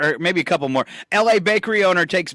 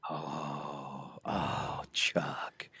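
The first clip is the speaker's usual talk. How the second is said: whisper; 18 dB quieter, much slower than usual. Real, not acted. Disappointed sigh at Chuck.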